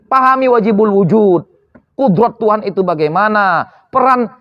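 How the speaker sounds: ordinary delivery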